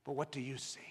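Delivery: low, soft, searching question; quiet appeal to each listener; hushed challenge. Real, not acted. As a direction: drop right down and ask it intimately. Same question, now dropped soft.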